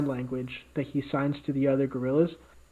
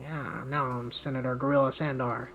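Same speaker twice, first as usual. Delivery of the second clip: softer, bratty put-on little brat voice; smug brat announcing himself, voiced lightly; little shit boy voice; 3 semitones lower, 7 dB quieter than usual. Gorilla senator announcing his new name, in a bratty boy voice. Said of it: "that same, like, little shit boy voice".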